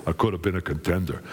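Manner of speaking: low, fast movie-boxer impression; deep-voiced, rapid boxer lament; Rocky Balboa. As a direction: drop deep and run it together like a boxer. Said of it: "Wait, he would have said".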